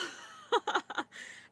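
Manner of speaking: breathy laugh through tears; teary laugh. Real, not acted. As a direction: laugh it off while tearing up. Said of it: "I'm getting emotional".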